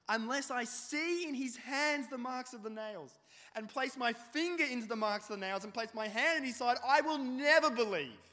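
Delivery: defiant Thomas. Acted. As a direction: swear it with clenched fury. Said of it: "Verse twenty-five".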